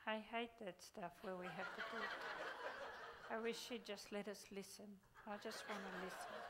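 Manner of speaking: softly mocking a reluctant listener, with a laugh; a hint of a grumbling listener; mock whiny audience member. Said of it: "anybody sitting there going".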